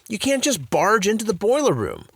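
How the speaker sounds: high, indignant protest. A narrator's reading. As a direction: protest firmly. Principal protests.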